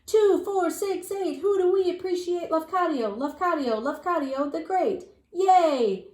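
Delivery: high, loud crowd cheers; cheering crowd. A narrator's reading. Parade crowd cheering for Lafcadio, loud and high. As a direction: whoop it up like a whole parade crowd. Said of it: "the people were cheering".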